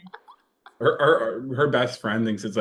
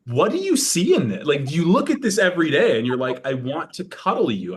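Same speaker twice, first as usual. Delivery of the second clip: incredulous mock; 7 semitones higher, 6 dB louder than usual. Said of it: "And I'm like".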